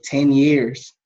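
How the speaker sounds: ordinary talk, nothing marked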